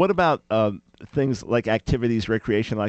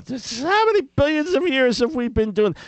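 exasperated mockery; 14 semitones higher, 5 dB louder than usual. Mocking endless heaven worship.

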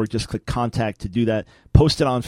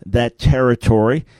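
usual speaking voice

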